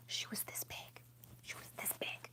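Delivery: very soft breathy whisper; hushed whisper. Real, not acted. Whispering to viewers how big the spider was. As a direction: whisper it in awe.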